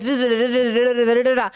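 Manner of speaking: mock nonstop babble in quick, even syllables; rapid-fire kid chatter. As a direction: imitate your childhood motormouth with rapid da-das. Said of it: "talked like this as a kid".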